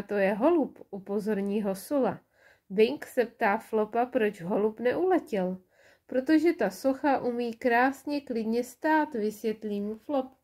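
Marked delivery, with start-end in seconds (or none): none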